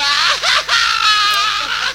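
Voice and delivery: high laughing cry that rises; laughing wail. Acted. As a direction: wail and laugh together, rising. Laughing wail after doña María. (risa llorosa y aguda)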